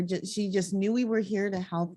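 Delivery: usual speaking voice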